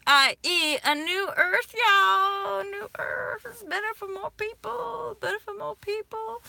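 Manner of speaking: high, loud, near-tearful cheer; fervent excited cry. Real, not acted. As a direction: lift up like a rallying cry. Excited vision of new earth.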